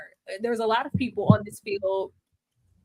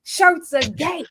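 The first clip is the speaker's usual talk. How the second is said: mocking teammates; 2 semitones higher, 3 dB louder than usual. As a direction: sneer it like the jocks.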